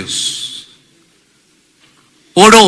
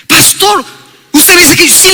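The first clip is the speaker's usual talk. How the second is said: surprised exclamation; 6 semitones higher than usual. Retold or imitated. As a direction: sound amazed at the permission. Brother surprised at pastor's advice.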